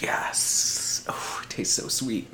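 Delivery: hushed whisper savoring the taste; whispered delight at the sweet taste. Real, not acted. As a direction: breathe it out, almost silent. Savoring the beer.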